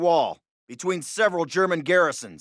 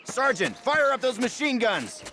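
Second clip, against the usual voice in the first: calling out; 8 semitones higher than usual. Acted. Calling to another sergeant.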